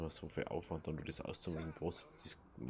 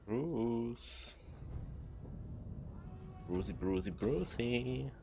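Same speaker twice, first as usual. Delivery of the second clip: teasing sing-song call; 6 semitones higher, much slower than usual. Pretend. Player calling the game orc.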